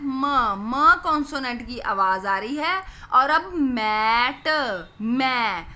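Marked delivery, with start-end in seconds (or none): 3.1-4.5 "मैट" stretched very slowly to show the long ए sound. Shown as a demonstration. Teacher to kids, stretching the long vowel. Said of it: "म के बाद ए की आवाज़ आ रही है".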